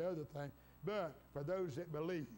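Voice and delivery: ordinary delivery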